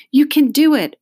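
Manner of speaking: high, loud cheer of encouragement; urging. A narrator's reading. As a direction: urge them on, loud and high. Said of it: "urged Marisha".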